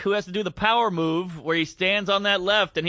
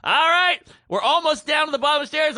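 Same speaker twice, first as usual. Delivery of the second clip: loud, higher, fast hurry-up call; impatient urging; 8 semitones higher, 4 dB louder than usual. Retold or imitated. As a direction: rush them down the stairs out loud. Urging couple down stairs.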